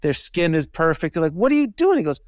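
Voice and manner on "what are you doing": high, loud amazed question; amazed friend. Retold or imitated. Old college friend marveling at fit classmate, amazed. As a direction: gush in admiration.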